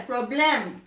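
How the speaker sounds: "Problème" said the French way; French accent. Shown as a demonstration. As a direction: model the French pronunciation clearly. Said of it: "you just say it in a French accent".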